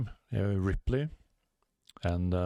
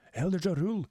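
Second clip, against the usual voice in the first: higher urgent shout; higher calling giant voice; giants' call; 6 semitones higher, faster than usual. Acted. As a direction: call to the elder in alarm.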